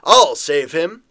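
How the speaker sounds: slow, loud heroic call; heroic shout. Acted. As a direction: call out boldly to the crowd. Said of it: "a shout arises".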